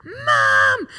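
put-on high, loud child's yell for mum; Jaden screaming. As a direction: imitate a boy hollering from another room. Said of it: "I heard a scream from Jaden".

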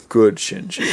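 low, deadpan father figure; Gendo. Acted. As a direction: drop low for a mock-solemn verdict. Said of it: "And he's like".

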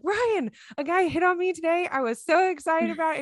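giddy excitement. Retold or imitated. Calling her husband to share the compliment, giddy and high. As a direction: gush like breaking big news.

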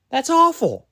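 high, scornful verdict; disgust. Real, not acted. Podcaster ranting about the draft bust, high disgust. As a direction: spit out the judgment.

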